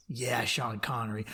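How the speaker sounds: low, quiet imitation of secret approval; hushed agreement. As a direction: murmur it under the breath. Voicing every man quietly agreeing.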